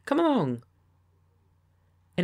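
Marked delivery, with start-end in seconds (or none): 0.1-0.6 higher, brisk come along; eager call to come along; eager urging. Acted. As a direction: hurry him out the door. Repeats the urging invitation.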